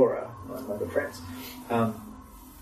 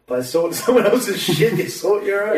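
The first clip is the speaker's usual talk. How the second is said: laughing punchline; 8 semitones higher, 5 dB louder than usual. Real, not acted.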